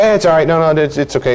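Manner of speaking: mock fussy comforting; acted reassurance. Acted spouse rushing to rescue.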